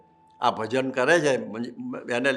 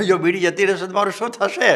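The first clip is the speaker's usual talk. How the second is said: higher, louder mock-worried smoker with a laugh; mock fretting addict; 7 semitones higher, 6 dB louder than usual. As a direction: ham up the panicked bidi addict, laughing. Mock addict fearing losing bidi. (હસતાં હસતાં બીડીબાજની નકલી ગભરામણ)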